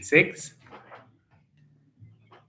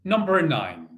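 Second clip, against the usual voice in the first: bingo caller; 4 semitones higher than usual. Acted. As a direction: call the numbers like a bingo hall host. Calling bingo numbers in rhythm.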